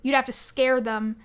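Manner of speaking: ordinary talk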